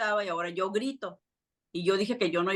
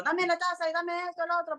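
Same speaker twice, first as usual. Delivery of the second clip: imitating mom yelling; 11 semitones higher than usual. Daughter imitates her mother barking orders at the kids, high mock yell. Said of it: "mi mamá gritaba".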